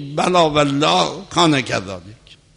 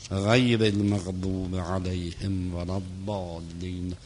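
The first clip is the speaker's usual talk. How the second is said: soft, low monotone recitation of Arabic prayer; 5 semitones lower, 10 dB quieter than usual. Real, not acted. Lecturer leading a Fatiha for the martyrs, hushed prayer recitation. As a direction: recite it quietly and low, on one even note.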